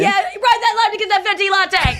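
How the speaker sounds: high, loud mock cheer; playful heckling shout. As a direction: holler it teasingly, like from the stands. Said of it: "you can shout anything".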